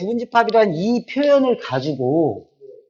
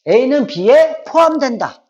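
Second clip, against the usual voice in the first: slow, raised, deliberate demo reading; slow reading aloud; 6 semitones higher, 4 dB louder, much slower than usual. Shown as a demonstration. Teacher to students, showing how to read the symbol, slow and raised. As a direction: spell it out slowly for the class. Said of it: "이렇게 읽을 수 있겠지".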